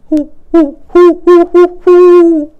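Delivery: high, loud hooting owl call; long, ringing owl hoots; great horned owl call. A narrator's reading. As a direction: hoot it out like a great horned owl across the snow. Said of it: "Then he called back".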